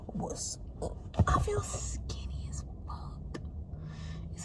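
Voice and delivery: slow, gleeful whisper; hushed, drawn-out gleeful whisper. Real, not acted. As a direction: whisper it like a happy secret. Gleeful over feeling skinny.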